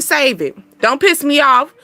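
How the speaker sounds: normal talking voice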